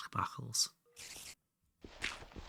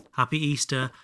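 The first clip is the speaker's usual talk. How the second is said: mock cheery greeting; 10 semitones higher, 7 dB louder than usual. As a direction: stretch it out high and silly. Joke greeting.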